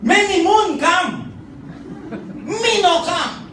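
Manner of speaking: high, loud, slow accusing pidgin; hammed-up angry husband; outraged chief in pidgin. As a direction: demand it like a betrayed chieftain, laughing through it. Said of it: "the husband said".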